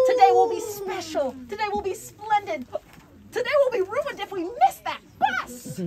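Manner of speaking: mother hurrying to catch the bus; frantic refrain. A narrator's reading. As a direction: build to panic about the bus.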